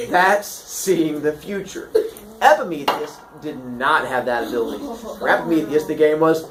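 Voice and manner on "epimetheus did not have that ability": ordinary delivery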